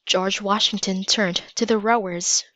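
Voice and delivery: usual speaking voice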